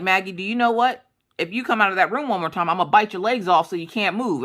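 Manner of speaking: mock threat to a child; fed-up mother. Mom warns daughter to stay upstairs, mock-exasperated threat.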